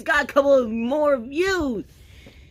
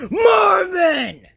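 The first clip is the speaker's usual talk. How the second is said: loud, high yell of the name; angry shout; 9 semitones higher, 7 dB louder than usual. Acted. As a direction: bellow his name in frustration. Yelling at Marvin again.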